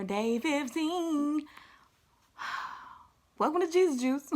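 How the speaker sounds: lifted, drawn-out show-host welcome; playful high announcer welcome; high sing-song intro. Shown as a demonstration. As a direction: sing the welcome up high and slow, then laugh.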